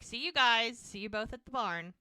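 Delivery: light imitation of calling across a hallway; retold yell. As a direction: call out to a departing couple, half-heartedly. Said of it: "she yells to Mel and Jack".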